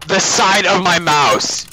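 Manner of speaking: loud, slow outraged repeat; exasperated shout. Real, not acted. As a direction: stretch it out in disbelief.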